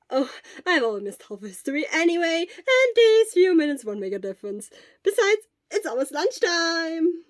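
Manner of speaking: a worried friend checking in; Hisashi. A narrator's reading.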